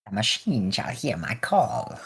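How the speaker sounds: slow, soft mock-solemn intoning; hushed, drawn-out Mechanicus put-on voice; mock tech-priest. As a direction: intone it like a priest calling on the machine. Playful Mechanicus priest chant.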